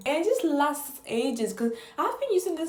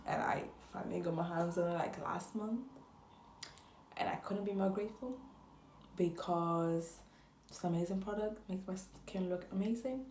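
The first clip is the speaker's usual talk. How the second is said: very soft, almost whispered; hushed reverence; 2 semitones lower, 12 dB quieter than usual. Real, not acted. Vlogger revealing her long-wanted product, hushed and reverent. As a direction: drop to a near-whisper of awe.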